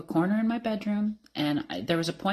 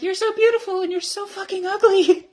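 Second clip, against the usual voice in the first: very high, loud squeal breaking into laughter; hammed-up high-pitched gushing; mock gushing; 9 dB louder than usual. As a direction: squeal it at your reflection, half laughing. Acting own reaction to mirror.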